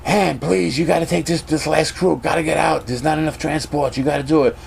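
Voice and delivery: high-pitched, pressing appeal; acted desperate pleading; urgent plea. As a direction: beg them as if time is running out. Acts out crew member pleading with Han.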